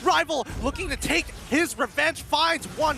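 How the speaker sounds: slow shouted kill call; esports caster. Acted. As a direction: roar the kill out slowly. Hype esports play-by-play.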